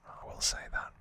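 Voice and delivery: ordinary talk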